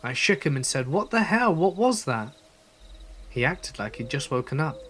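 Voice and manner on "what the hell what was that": high, urgent questioning; alarmed demand. Retold or imitated. Narrator shaking his friend, alarmed and high-pitched.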